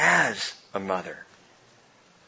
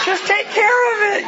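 high, loud retold order; hammed-up impatient yell; unsympathetic exasperation; 6 dB louder than usual. Dad with no compassion for spider panic, exasperated yell. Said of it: "I got no compassion".

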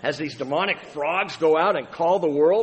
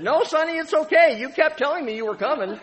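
put-on much higher, sweet little old lady; old widow voice; 11 semitones higher, 5 dB louder than usual. Widow in the front row answering the pastor, high and sweet. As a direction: play the startled widow, high and kindly. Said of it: "And she said".

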